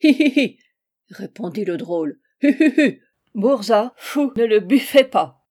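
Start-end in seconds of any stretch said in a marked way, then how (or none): 0.0-0.6 high, loud "hi hi hi"; mocking cackle. Monster laughing at the host's threat, mocking cackle. 2.4-3.0 high, loud "hi hi hi"; mocking cackle. Monster laughing at the host's threat, mocking cackle. 3.3-5.4 put-on loud German-accented jeer; taunting accented monster. Monster defying the host's threat, taunting creature.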